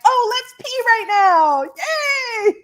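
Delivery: very high, slow, squealing little voice; a bladder cheering gleefully to pee; giddy bladder voice. Retold or imitated. Her bladder personified, cheering to pee right now, very high and giddy. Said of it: "And my bladder was like".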